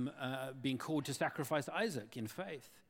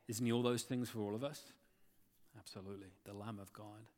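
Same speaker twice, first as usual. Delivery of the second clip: softer, lower closing sermon voice; tender preaching; 7 semitones lower, 5 dB quieter than usual. Acted. Preacher closing to his congregation, soft and low. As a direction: bring it down soft and personal.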